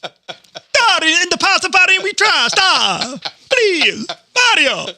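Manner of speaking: high hammed-up Italian accent; Mario impression. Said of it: "like Mario and Luigi".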